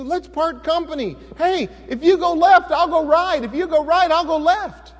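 loud, high, eager offer; generous Abram. Retold or imitated. Abram offering Lot first pick of the land, generous and loud. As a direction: offer him the choice with open arms. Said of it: "Abram said to Lot".

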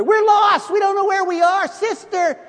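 put-on high, loud panicked girls' cries; shouting out. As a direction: squeal it out like lost schoolgirls calling for help. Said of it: "they were sort of shouting out".

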